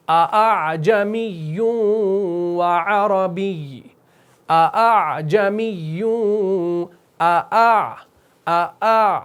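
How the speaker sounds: second آ softened, drawn-out recitation of جمی و عربی; very slow Quranic recitation; recited with tasheel. Shown as a demonstration. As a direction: chant slowly, easing the second hamza. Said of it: "اسی کو تسہیل کہا جاتا ہے".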